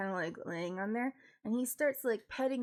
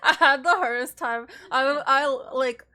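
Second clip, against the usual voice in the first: loud, still laughing through the words; 3 semitones higher, 10 dB louder than usual. Real, not acted. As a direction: talk through leftover laughter.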